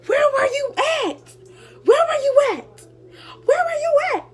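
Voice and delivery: high, loud imitated yell; mock yelling mom. Daughter imitating her mom yelling where were you at. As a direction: shriek it as the furious mom. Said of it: "she started yelling".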